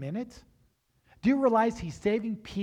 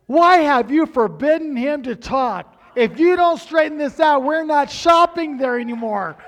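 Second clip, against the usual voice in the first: demanding customers; 8 semitones higher, 14 dB louder than usual. Retold or imitated. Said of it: "called the main office and said".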